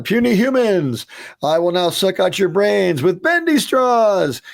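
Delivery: hammed-up alien menace, high and drawn out; typical alien. Writer reading the line as scripted for an alien, high hammy alien voice. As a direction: threaten like a stock cartoon alien. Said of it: "written Mark as an alien".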